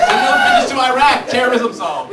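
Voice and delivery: very high, loud triumphant yell; excited shout. Real, not acted. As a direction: blurt it out in glee. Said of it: "triumphant loud idea".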